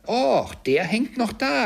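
put-on high, loud, drawn-out boy's voice; boy calling. A narrator's reading. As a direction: shout it up like an excited boy. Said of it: "rief der Knabe". (hoher Knabenruf)

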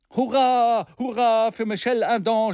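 high loud hurra; mock crowd cheer; crowd shouting. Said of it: "schrien die Anwesenden".

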